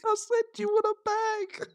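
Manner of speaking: high, far-off mimic of the cashier; mock shop assistant. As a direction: play the cashier repeating herself. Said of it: "She was like".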